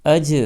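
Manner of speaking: اج punched loud, the ج bouncing; bouncing qalqala. Shown as a demonstration. Teacher demonstrating to students: loud bouncing اج. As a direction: hit اج hard and let the ج spring. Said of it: "اچھل جائے گی".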